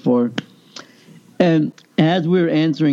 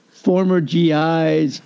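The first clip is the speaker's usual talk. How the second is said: ordinary talk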